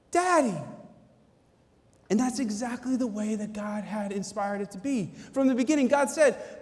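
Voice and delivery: usual speaking voice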